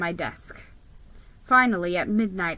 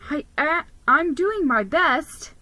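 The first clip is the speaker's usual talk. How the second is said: higher, timid little squeak; meek squeak; 6 semitones higher, 4 dB louder than usual. Acted. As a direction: shrink away and squeak it out. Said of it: "I squeaked meekly".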